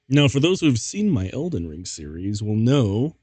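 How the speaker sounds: ordinary delivery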